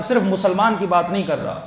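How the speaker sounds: ordinary talk, nothing marked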